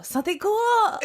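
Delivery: high put-on cry; mock exasperated repeat. Hero answering his confused partner, in high mock exasperation.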